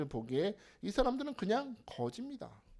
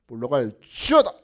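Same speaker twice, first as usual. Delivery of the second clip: exorcism shout; 7 semitones lower, 10 dB louder than usual. Retold or imitated. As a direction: bark it out to drive out the devil. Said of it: "원장님이 보여주시잖아요". (사탄을 쫓는 큰 외침)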